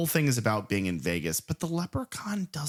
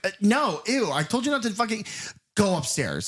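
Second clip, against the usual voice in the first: higher, grossed-out brush-off; disgusted and annoyed; 8 semitones higher than usual. Retold or imitated. Host voicing Tammy rejecting Scott, higher disgusted snub. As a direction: recoil from him with a high ew. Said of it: "she's, like, disgusted and annoyed".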